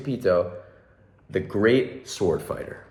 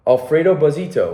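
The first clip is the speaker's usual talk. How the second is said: usual speaking voice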